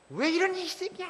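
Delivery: high-pitched mock screech of a raging mother; mock shriek. Pastor mimics a mother who has lost her reason, mock shrieking. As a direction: imitate a parent losing it, voice shot way up. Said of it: "괴성을 지르거나 화내시면 안 돼요".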